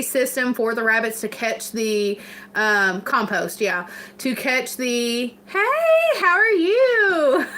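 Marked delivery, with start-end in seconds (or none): 5.5-7.5 high, drawn-out hello; delighted greeting. Real, not acted. Streamer greeting a newcomer mid-sentence, delighted. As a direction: sing out a happy greeting with a laugh in it. Greeting someone who just joined.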